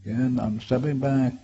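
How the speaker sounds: ordinary talk, nothing marked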